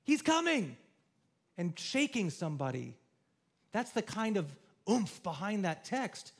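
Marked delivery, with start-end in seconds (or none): 0.0-0.8 high, loud, urgent call to wake; urgent wake-up shout. Retold or imitated. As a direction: shake them awake with your voice. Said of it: "It's like grabbing somebody and saying".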